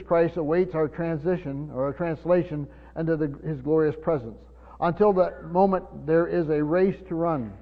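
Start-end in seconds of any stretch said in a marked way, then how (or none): 4.8-7.5 louder, driving preacher cadence; preaching at full force. Real, not acted. Pastor exhorting the church to run the race, full force. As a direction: rouse them to run the race.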